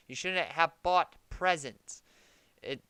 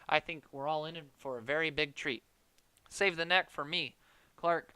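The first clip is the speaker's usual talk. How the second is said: usual speaking voice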